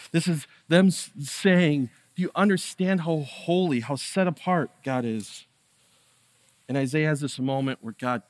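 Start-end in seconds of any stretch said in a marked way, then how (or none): 0.1-5.5 louder, higher preaching at full force; impassioned preaching. Acted. Pastor stirring the congregation with the vision, impassioned. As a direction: stir the room with wonder.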